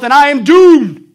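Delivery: slow, high, loud despairing cry; doomed cry. Retold or imitated. Preacher quoting a doomed man, slow high loud cry. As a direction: stretch out the doom.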